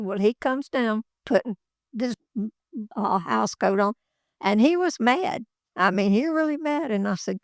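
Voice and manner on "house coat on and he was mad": ordinary delivery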